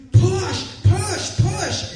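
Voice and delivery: very high, loud repeated shout; shouting push. Retold or imitated. Nurse yelling at Krista to push, full shout. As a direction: yell push like a delivery coach.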